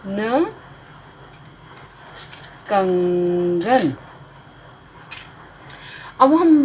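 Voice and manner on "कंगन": कंगन stretched slowly with nasal held; slow sounding out. Shown as a demonstration. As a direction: blend कंगन slowly for children. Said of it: "छोटे अ की मात्रा ग, न".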